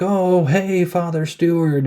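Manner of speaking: high-pitched imitation of a girl calling hello; mock cheery greeting. Retold girl greeting Father Stewart on a battlefield, mock cheery. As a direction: put on a high, sweet hey-there voice.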